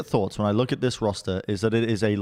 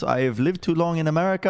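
ordinary delivery

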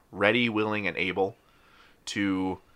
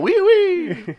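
wee wee in a squeaky mock French voice, much higher; mock French oui oui; 8 dB louder than usual. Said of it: "the show in the French accent".

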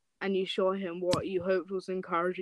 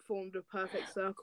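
ordinary talk, nothing marked

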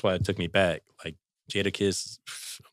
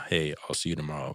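monotone voice; 4 semitones lower, 6 dB quieter than usual. Retold or imitated. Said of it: "and I rap like".

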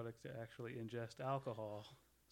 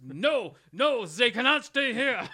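put-on hammy high, loud German accent; 12 semitones higher, 21 dB louder, slower than usual. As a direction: overblown villain in a German accent. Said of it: "the worst German accent ever".

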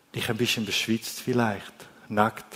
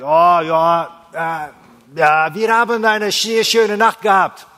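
boastful fisherman; 5 semitones higher, 7 dB louder than usual. Retold or imitated. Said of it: "Zu jemand anderes hätten die dann gesagt".

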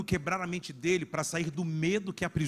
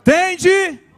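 calling out; 15 dB louder than usual. Acted. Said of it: "Jesus imediatamente lhe disse".

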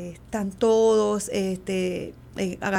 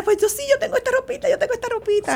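put-on eager little girls; 3 dB louder than usual. Daughters eagerly offering baby clothes.

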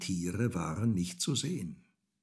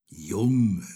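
drawn-out, louder admonishing call; lightly voiced old moon; 7 dB louder than usual. A narrator's reading. The good old moon addresses Häwelmann, admonishing. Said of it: "sagte der gute alte Mond".

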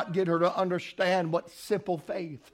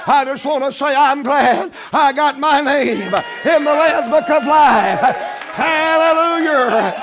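high, loud, drawn-out preacher's shout; full-force preaching; 10 semitones higher, 12 dB louder, slower than usual. Real, not acted. Preacher urging the church to keep coming, preaching at full force. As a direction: preach it hard, pushing every phrase up high.

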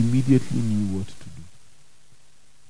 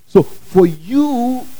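slow dramatic build; 9 semitones higher, 5 dB louder, much slower than usual. Acted. Preacher drawing out phrase.

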